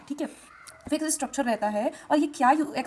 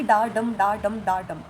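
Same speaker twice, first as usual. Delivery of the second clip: steady da-DUM rhythm on "डा डम"; "डा डम" beaten out like verse meter; iambic beat; 3 semitones lower, 4 dB louder, much slower than usual. Shown as a demonstration. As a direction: chant "डा डम" in pairs, weak "डा", stressed "डम". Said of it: "एक stressed रहेगा".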